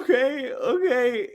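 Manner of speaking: high, teary mock sob of okay; imitating tearful kid. Retelling the bullied kid's tearful okay, high mock crying. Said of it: "this kid's lips started quivering".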